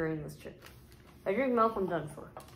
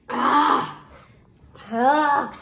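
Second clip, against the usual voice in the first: real loud pained cries; pained shouts; 5 semitones higher, 10 dB louder than usual. Real, not acted. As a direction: shout through the burning pain. Burning mouth from hot chip.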